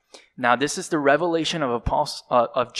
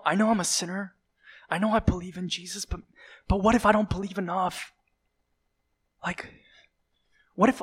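fast, anxious and higher, dropping to a whisper; panicked fear; 5 semitones higher, faster than usual. Acted. Church members fearing their faith is fake, panicking. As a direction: rush the worry like a frightened believer. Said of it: "And they're just like".

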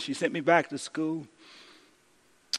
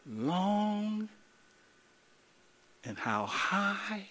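soft, slow, drawn-out reading with long pauses; hushed scripture reading; 5 semitones lower, 10 dB quieter, much slower than usual. Acted. Pastor reading Paul's prayer to the congregation, hushed and slow. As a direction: let each word hang in the quiet.

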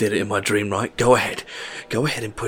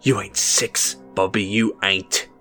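furious rant; 3 semitones higher, 9 dB louder than usual. A narrator's reading. Red raging at boy.

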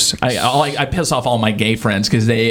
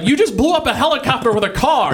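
astonished; 12 semitones higher than usual. Retold or imitated. Retelling movie quote.

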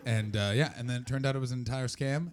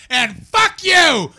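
loud, much higher, slow explosive curse; furious yell; 17 dB louder, much slower than usual. Retold or imitated. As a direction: scream it at the caller. Yelling at customer while boss watches.

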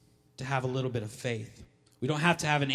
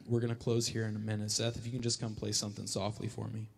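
soft, fast aside to musician; quiet aside; 5 semitones lower, 7 dB quieter, faster than usual. Real, not acted. Preacher asking Seth for soft music, quick and soft. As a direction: quickly murmur to the keyboard player.

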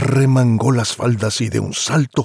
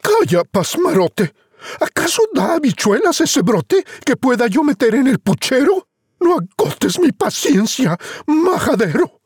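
high, scolding mother voice; impatient mother. Acted. Mother scolds Juan, losing patience. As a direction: snap at the dimwit son. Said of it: "La madre dijo".

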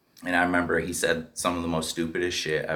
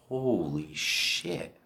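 stunned hush; 8 dB quieter, much slower than usual. Retold or imitated. Stunned at the girl's eyes.

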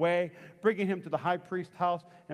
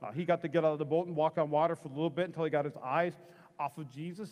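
ordinary talk, nothing marked

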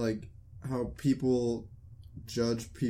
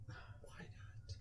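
breathy whispered "Why not?"; 24 dB quieter than usual. Real, not acted. Friend asks why he can't get ice cream, in a whisper. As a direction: drop to a whisper.

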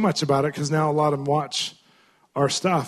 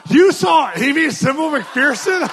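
astonished shout; 10 semitones higher, 5 dB louder, slower than usual. Retold or imitated. Amazed shout at great aunt.

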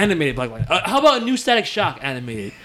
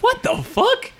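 high-pitched real disbelief; genuinely baffled, pitch shooting way up; incredulous; much slower than usual. Real, not acted. Reacting to surprise spinoff news.

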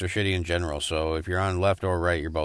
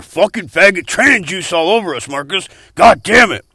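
higher, louder angry yell; 8 semitones higher, 11 dB louder than usual. Acted. Host yelling at Marcus about the juice, angry yell. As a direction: scream him down.

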